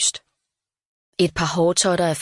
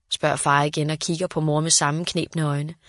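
plain reading voice

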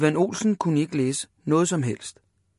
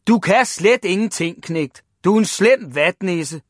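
raised, loud, scornful telling-off; shouted scolding; 4 semitones higher, 6 dB louder than usual. A narrator's reading. Father calling Ivan a weakling, shouting. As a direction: berate your son loudly. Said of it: "råbte hans far".

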